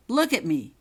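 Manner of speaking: loud sharp order; command. Acted. Prefect with wand at Holly's chin, loud command. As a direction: snap the order at her. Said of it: "he commanded".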